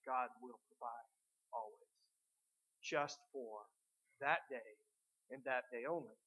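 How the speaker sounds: preacher hushing for God's promise; low solemn promise. Acted. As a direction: murmur it like a sacred promise. Preacher drops into solemn reassurance.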